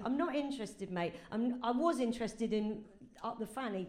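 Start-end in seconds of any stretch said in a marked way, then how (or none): none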